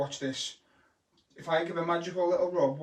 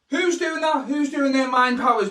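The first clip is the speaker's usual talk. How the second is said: mock scolding; 6 semitones higher, 7 dB louder than usual.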